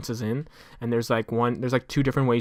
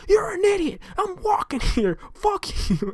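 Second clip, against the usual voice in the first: much higher furious granny yell; old woman yelling; slower than usual. Retold or imitated. Old woman yelling at the driver who nearly hit her, mock shriek. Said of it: "just yelling at him".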